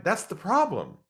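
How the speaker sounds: mild mock alarm. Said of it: "I'm like".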